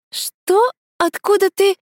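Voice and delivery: high startled outburst. A narrator's reading. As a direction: jolt as if caught out. Startled by question about Edik.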